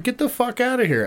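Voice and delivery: high mock yell, little added volume; retold yell. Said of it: "And so I'm yelling at him".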